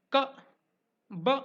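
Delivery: "K" and "b" cut very short; isolated, clipped "K", then "b", vowel barely there; half consonant. Shown as a demonstration. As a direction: say "K" and "b" as half sounds, stopped abruptly. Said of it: "When you want to say a consonant just half".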